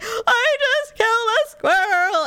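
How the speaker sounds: high, slow, hammed-up crying wail; distraught wail. Driver confessing to husband by phone, tearful wail. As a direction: wail it out in mock anguish. Retells own distress after hitting squirrel.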